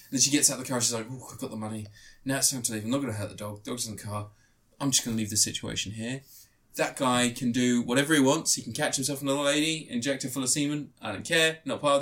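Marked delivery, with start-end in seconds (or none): 1.1-6.2 low, much softer gloating aside; sly heroine. Retold or imitated. Retelling the film, the girl quietly gloats over the money. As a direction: murmur it low like a smug getaway. Heroine voiced leaving the car.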